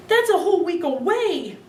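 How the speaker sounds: high, indignant cry; a boy protesting a week without TV; outraged kid. A narrator's reading. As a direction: protest like it's forever. Boy outraged about waiting.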